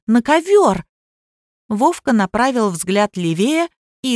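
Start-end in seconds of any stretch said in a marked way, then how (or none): 0.1-0.9 high-pitched excited squeak. A narrator's reading. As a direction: squeak it out high, like a startled girl. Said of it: "запищала Лизавета".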